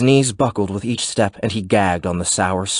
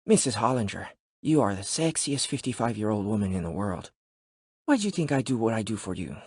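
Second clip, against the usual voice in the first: soft seductive trainer; 2 semitones higher, 8 dB quieter than usual. Acted. Narrator voicing Juan Carlos.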